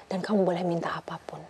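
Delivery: hushed whisper of a secret; soft confiding whisper. Retold or imitated. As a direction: breathe it softly like a mother's secret. Whispered advice from mother.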